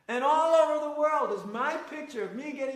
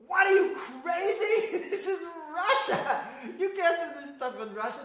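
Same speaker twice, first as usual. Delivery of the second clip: incredulous; 8 semitones higher, 4 dB louder than usual. Retold or imitated. Incredulous reaction to foot washing.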